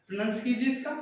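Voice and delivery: ordinary talk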